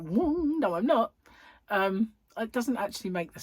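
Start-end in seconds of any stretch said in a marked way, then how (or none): 0.0-1.1 high, loud "whoa" swelling up; sudden cartoon rebound. Eye lump suddenly growing back, high mock protest. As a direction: voice something popping back up in protest. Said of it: "all of a sudden it's like".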